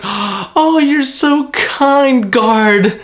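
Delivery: high, very slow, starry-eyed gushing; naive adoring. Retold or imitated. Naive Tontadas thanking the fake-kind guard, high and drawn out. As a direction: gush at the guard in total innocence. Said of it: "the Tontadas are like".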